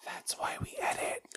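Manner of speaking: breathy hush; whispering. Real, not acted. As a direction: keep it to a whisper.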